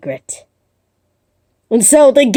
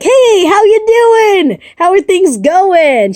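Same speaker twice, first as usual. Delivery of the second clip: much higher, louder, slow forced cheer; fake cheerful greeting; 9 semitones higher, 5 dB louder, slower than usual. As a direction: sing out a falsely bright hello. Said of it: "trying to look more polite and friendly".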